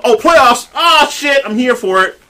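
light up about the playoffs; thrilled football fan; excited. Real, not acted.

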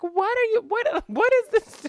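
put-on very high, bewildered child's voice; boy baffled by his grandma's hitting, very high; bewildered little boy. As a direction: ask like a small boy who can't understand. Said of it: "He was like".

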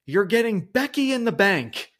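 high, loud mock of the commentator's call; mocking the commentator's cringe catchphrase, high and loud; Kevin Patrick impression. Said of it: "I can't do his accent".

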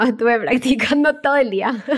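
real laughter breaking into the words; amused, laughing while talking. Real, not acted. Jokes about saying hard name.

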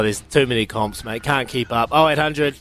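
ordinary talk

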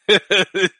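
a burst of helpless giggling; stifled giggle. Real, not acted. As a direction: giggle you can't hold in. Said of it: "[name]'s still losing it".

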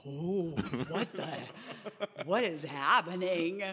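slow, laughing disbelief; amazed laughing. Retold or imitated. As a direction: marvel through a laugh. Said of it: "It was so like".

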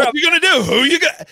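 loud, high wrestler hype; wrestling promo. Retold or imitated. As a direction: bellow it like a ring announcer taunt. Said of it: "it sounds like you're doing a wrestling promo".

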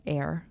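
"air" ending in a sounded R; "air" in American pronunciation; American rhotic R. Shown as a demonstration. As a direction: pronounce the R at the end of "air". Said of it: "in America they say".

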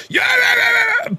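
sudden shrill yell of yeahs; mock a panicky overreaction, very high and loud; squealed hammed-up yeahs; mock frantic yelling. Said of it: "So he wasn't sitting there".

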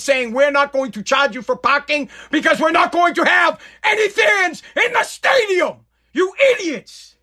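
fed up yelling at the press team; exasperated shout. Retold or imitated. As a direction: blow up at the idiots. Ranting at a bad idea.

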